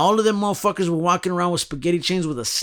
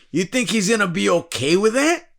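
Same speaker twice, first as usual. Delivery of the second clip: ordinary talk, nothing marked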